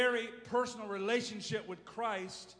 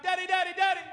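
put-on high shouted child's call for daddy; child crying out; 11 semitones higher, 9 dB louder, much faster than usual. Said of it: "Kids crying out".

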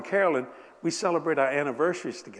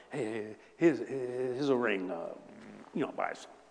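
soft, slow, trailing mumble; sheepish mumble; 4 semitones lower, 8 dB quieter than usual. Retold or imitated. Husband giving his wife a ring instead of words, mumbling. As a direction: hand it over sheepishly, fading.

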